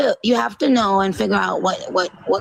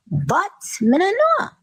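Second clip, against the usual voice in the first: mock Caribbean accent; 10 semitones higher than usual. Guest mocking [name]'s Caribbean talk, mock Caribbean accent. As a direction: tease her with a Caribbean "me no know". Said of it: "Notice the accent flip-flop".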